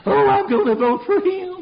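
very high, silly mimicry; mocking gushing voter. Preacher mimics suckers won over by a politician, mocking gush. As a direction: squeal it like a gullible fan. Mocks gullible voters out there.

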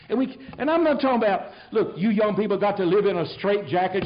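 loud, high, driving sermon voice; fiery preaching. Acted. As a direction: preach it hard to the congregation. Preaching at full force.

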